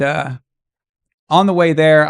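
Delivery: normal talking voice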